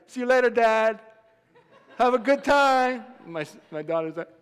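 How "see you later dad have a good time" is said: loud, high cheerful send-off; imitated boy hollering a breezy goodbye; carefree son. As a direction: call it out like an untroubled kid. Acts out carefree son's goodbye.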